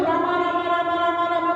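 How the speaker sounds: words run together on one even note; nonstop repetition. Shown as a demonstration. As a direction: repeat it over and over like a chant. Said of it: "एक बहुत देर से".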